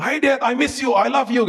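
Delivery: put-on high, eager child's greeting; sing-out high, gushing hello; young children greeting. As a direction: imitate kids running to hug their dad. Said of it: "Dulu anak-anak saya waktu pulang".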